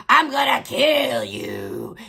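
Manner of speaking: lightly growled threat, slow; imitating her demon voice, slowed down; mock demon voice. Streamer mocking a woman's bar rant, mock demon voice. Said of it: "talking in your demon voice".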